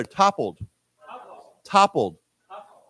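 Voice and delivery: TOPPLED said alone, stress on TOP, falling; model pronunciation. Shown as a demonstration. Teacher leading students in repeating, clear model of TOPPLED. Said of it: "Why don't we say together".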